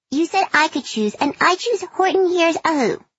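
put-on high, indignant child voice; a kid holding her mother to her word; sassy little girl. A narrator's reading. Child hand on hip.

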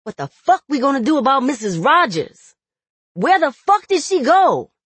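loud, high-pitched angry demand; upset man demanding answers; upset outburst. A narrator's reading. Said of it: "never seen him so upset before".

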